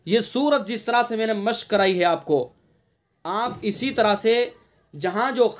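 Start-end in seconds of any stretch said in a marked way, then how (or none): none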